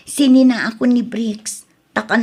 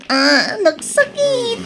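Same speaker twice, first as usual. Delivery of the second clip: pained groan; 9 semitones higher, much slower than usual. Acted. Injured wife in pain.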